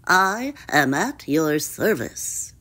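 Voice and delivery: obliging, croaky frog; courteous froggy voice; croaking frog. A narrator's reading. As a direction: offer help in a frog's croak. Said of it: "he croaked".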